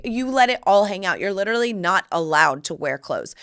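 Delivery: ordinary delivery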